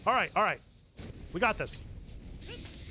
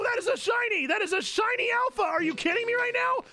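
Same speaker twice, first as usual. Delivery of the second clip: higher, rising excited whoas into shouts; excited shouting; 8 semitones higher than usual. Real, not acted. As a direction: shout it out, can't believe the shiny. Finds a shiny alpha.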